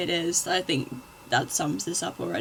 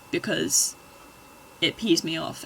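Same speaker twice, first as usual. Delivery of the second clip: ordinary talk, nothing marked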